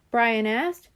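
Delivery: ordinary delivery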